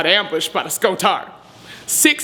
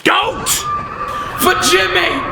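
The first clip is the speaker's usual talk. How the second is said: battle cry; 13 semitones higher, slower than usual. Acted. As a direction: yell it charging into battle. Charging into battle, shouting.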